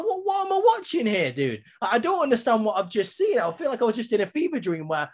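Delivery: high-pitched bewildered rant at the wrestlers; exasperated disbelief. Retold or imitated. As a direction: squeak out your disbelief at the screen. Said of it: "I was like".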